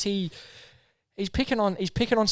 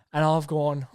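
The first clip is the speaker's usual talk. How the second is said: ordinary talk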